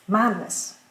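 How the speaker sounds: "Madness" with "mad" strong and "ness" weak; stress on MAD. Shown as a demonstration. Teacher showing the accent stays put, "Madness" stressed on "mad". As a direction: hit the first syllable of "Madness", drop the ending. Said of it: "l'accent reste sur mad".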